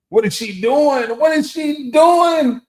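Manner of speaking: very high, loud mock scream; playful screaming. Speaker copying co-host's screaming, drawn-out playful shriek. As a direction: shriek it slowly, drawing out the last word. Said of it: "start screaming like you do".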